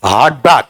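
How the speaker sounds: Heart break with equal weight on each word; both syllables stressed. Shown as a demonstration. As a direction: punch heart and break alike. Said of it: "पढ़ के बताओ क्या है?".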